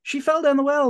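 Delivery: put-on high-pitched kids raising the alarm; boys' mock scream. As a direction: mock-scream it like panicked boys, without full volume. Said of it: "the boys scream".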